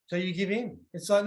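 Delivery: usual speaking voice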